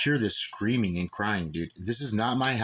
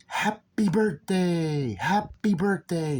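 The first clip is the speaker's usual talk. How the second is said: slow, high, stretched-out mock yell; high singsong holler, drawn out; retold yelling; 9 semitones higher, 4 dB louder, much slower than usual. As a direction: imitate someone yelling, dragging out each word. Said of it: "I hear somebody yelling".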